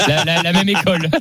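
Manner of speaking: giggling through the words; laughing. Real, not acted. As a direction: laugh while teasing a colleague. Laughing at the accent joke.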